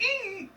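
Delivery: very high mock-crazy "ee"; psycho squeal. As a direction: let out a tiny unhinged squeal. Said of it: "just going crazy, that little psycho".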